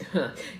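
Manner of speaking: short disbelieving laugh; quick incredulous chuckle. Acted. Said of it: "He tugs on his ear and laughs".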